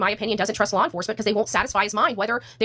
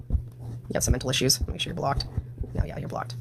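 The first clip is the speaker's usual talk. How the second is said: whispered under the breath; muttered aside; 7 semitones lower, 5 dB quieter than usual. Real, not acted. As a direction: mutter it while blocking them. Muttering at a troll commenter.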